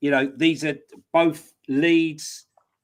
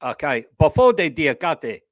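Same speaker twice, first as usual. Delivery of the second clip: a playful mock Welsh accent, a little louder; 5 dB louder than usual. Pundit has a go at the new signing's name in a mock Welsh accent. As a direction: roll the player's name out in your best Welsh accent. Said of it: "That's my best Welsh accent".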